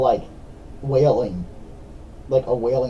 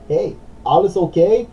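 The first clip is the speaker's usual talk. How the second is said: higher-pitched, badly faked Swedish accent on German; terrible Swedish accent; 8 semitones higher, 4 dB louder, slower than usual. Stranger checking on someone who collapsed, terrible Swedish accent. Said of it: "That was a terrible attempt at a Swedish accent".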